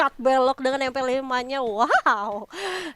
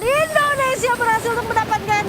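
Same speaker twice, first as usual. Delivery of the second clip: excited shout; 6 semitones higher than usual. Real, not acted. Caster hype on round win.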